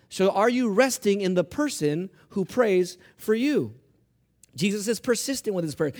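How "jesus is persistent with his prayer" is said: ordinary talk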